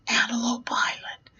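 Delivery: breathless whispered awe; awed whisper. Retold or imitated. Clown flying over Antelope Island, awed whisper. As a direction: hush the words as if gazing down in wonder.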